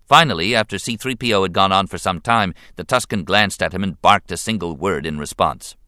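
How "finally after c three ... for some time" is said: ordinary delivery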